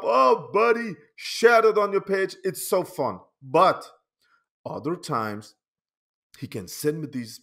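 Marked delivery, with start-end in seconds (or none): 0.0-3.2 loud cheerful praise to a friend; excited friend on phone; excited call. Retold or imitated. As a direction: gush over the meme loudly. Calling friend excitedly about meme.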